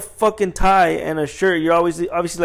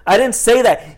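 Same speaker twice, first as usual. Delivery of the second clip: higher, louder retold protest; insistent denial; 7 semitones higher, 6 dB louder than usual. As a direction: push back emphatically, reenacting the quarrel. Second denial in retold quarrel.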